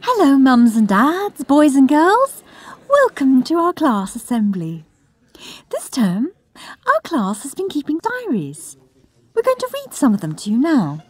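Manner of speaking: high, bright-eyed teacher addressing the hall; chirpy teacher. Acted. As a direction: gush over her favourite pupil.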